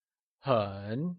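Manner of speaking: 很 low and slow, falling then rising; careful dipping model of 很; third tone. Shown as a demonstration. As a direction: draw 很 down softly and bring it up. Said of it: "or the third tone".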